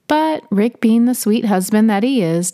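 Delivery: ordinary delivery, nothing marked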